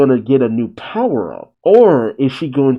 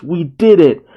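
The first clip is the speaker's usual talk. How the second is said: higher-pitched cheer of triumph; delighted shout; 5 semitones higher, 4 dB louder than usual. A narrator's reading. Pino celebrates beating the clown, delighted shout. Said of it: "Pino delightfully shouts".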